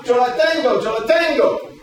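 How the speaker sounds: high, loud Yo la tengo; called out in Spanish. Shown as a demonstration. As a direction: shout it like calling the catch. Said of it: "you go".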